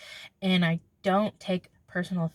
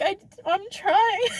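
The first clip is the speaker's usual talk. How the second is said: high, drawn-out protest; mock pleading; 12 semitones higher, 4 dB louder, slower than usual. Defending self to an angry sister, mock pleading. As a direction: plead your case, slow and high.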